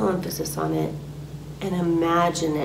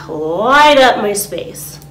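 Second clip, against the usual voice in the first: louder and slow, each word given weight; firm declaration; 2 semitones higher, 10 dB louder, slower than usual. Shown as a demonstration. Teacher shows listeners the command, loud and slow. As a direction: call the light in, firmly. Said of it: "you can say".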